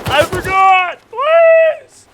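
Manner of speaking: high, loud screaming plea; panicked scream. Real, not acted. Being killed by the guys, screaming for mercy. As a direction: shriek in panic.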